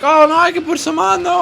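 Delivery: high, chirpy imitation of a helpful waitress; mock the over-eager server sweetly. Imitating the server offering coffee late at night, high and chirpy.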